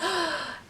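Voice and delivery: sharp, startled intake of breath; re-enacted gasp of fright; shocked gasp. Friend spots hair over the stair rail, shocked gasp.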